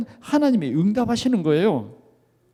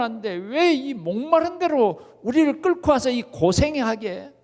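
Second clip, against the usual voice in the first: louder, slow, higher indignant grumble; angry complaint; 5 semitones higher, 4 dB louder, slower than usual. Acted. Israelites complaining to Moses, angry and loud. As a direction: bitterly demand why they were led here.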